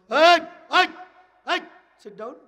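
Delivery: sharp loud call; জোরে ধমকে ডাকা; shouting for attention. Real, not acted. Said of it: "shouting at audience member".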